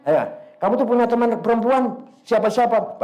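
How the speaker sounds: ordinary delivery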